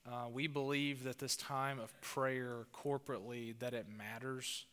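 soft, quiet prayer voice. Acted. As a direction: pray softly and earnestly to God. Opening prayer before sermon.

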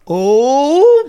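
high, loud goofy Andy Dwyer "oh". Retold or imitated. Texting a wrong number, a high goofy "oh". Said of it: "Andy Dryer from Parks and Recreation going".

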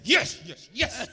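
loud, exploding yes; inner jubilation. Shown as a demonstration. Prophet secretly celebrating a correct word, loud jubilation. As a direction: cheer wildly, as if inside your head.

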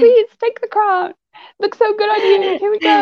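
sugary mock humility to a rival; mock gracious handover. Rival giving up the crown to Chloe, sweetly. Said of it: "so I'm just gonna be like".

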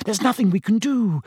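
There's nothing we can do, snapped in exasperation. A narrator's reading. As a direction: throw "nothing" at him impatiently. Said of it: "snapped Hermione".

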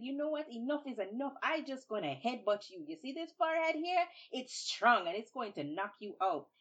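high, loud fed-up threat; upset Babuki. Acted. Babuki, upset, threatens a headbutt loudly. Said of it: "He gets so upset".

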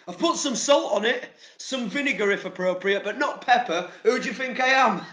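mock indignation. Vlogger mocking English blandness with hammed-up outrage. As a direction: play the offended Englishman.

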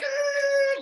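high-pitched comic scream; hammed-up high "aah"; mock panicked scream. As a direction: imitate a panicked scream at the wheel. Acted panic while driving.

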